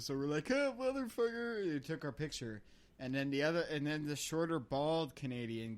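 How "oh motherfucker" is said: high, sustained say-cheese call; acted photo pose. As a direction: sing it out as for a group photo. Acting out posing for a photo.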